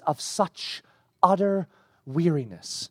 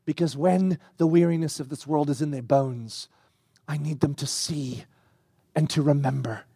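tender; 4 semitones lower than usual. Acted. God telling John why they need it, low tender and fading. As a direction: say it gently, as if to the weary.

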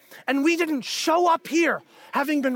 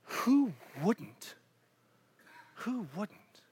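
hushed wonder; 3 semitones lower, 9 dB quieter, much slower than usual. Retold or imitated. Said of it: "He's just like".

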